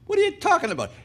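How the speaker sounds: very high, baffled squawk; incredulous. Acted. Woman questioning the angel's carrot plan, incredulously. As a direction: exclaim in high-pitched disbelief.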